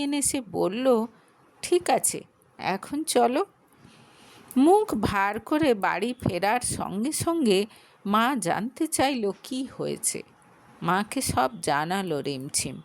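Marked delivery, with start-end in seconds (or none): none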